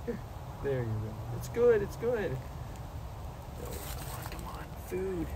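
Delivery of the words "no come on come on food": unvoiced soft coaxing; whisper. Real, not acted. Owner whispering to coax his possum to eat. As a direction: whisper to coax her back to the food.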